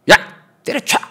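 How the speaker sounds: loud barked order to quit; mock angry yell. Preacher imagining himself yelling at a grumbling assistant, loud. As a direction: shout it like a fed-up boss. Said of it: "저 같았으면".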